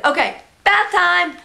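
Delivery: high, slow sing-song bath time; cheery announcement. Retold or imitated. Mom telling her baby it's bath time, cheery sing-song. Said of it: "I said".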